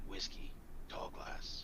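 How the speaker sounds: slow, whispered character voice; quiet order. Acted. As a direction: whisper the order slowly to the barkeep. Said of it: "I'm gonna quietly say".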